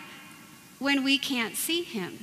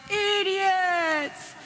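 higher mock yell of "Idiots!"; yelled insult; 7 semitones higher than usual. Driver yelling at priests from car window, mock yell. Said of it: "yells out their car window".

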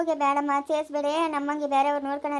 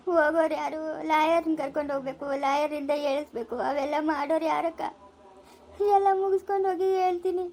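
tearful lament, words drawn into a wail; bereaved mother's helpless weeping; crying. Acted. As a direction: pour out your grief through tears. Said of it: "ಅಳ್ಬೇಡ ಸುಮ್ನಿರು".

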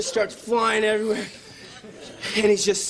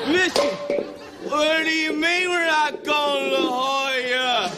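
put-on drunk shouting guy; 6 semitones higher than usual. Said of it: "he's like".

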